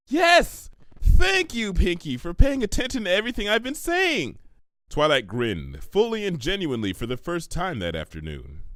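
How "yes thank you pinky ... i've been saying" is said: higher, louder, thrilled outburst; overjoyed someone finally listened; delighted. Acted. Twilight thanking Pinky, overjoyed and loud.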